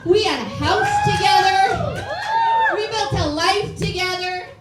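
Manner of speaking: high, loud hammed-up lament; mock anguished wail. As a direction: overplay the heartbreak for laughs. Mock dramatic breakup lament.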